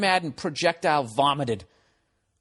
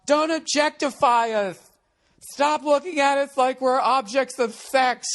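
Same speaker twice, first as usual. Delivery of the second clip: higher, slowed, lightly put-on mocking voice; sarcastic imitation of the singers; 9 semitones higher, slower than usual. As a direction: parody a performer's protest. Mocking performers' complaint.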